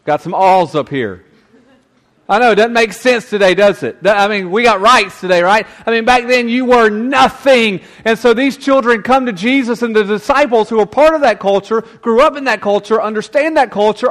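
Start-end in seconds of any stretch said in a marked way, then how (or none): none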